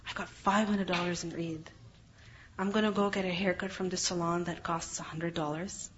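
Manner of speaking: softly voiced teen planning purchases; excited girl. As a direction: murmur the girl's shopping list. Girl voiced boasting about Eid money.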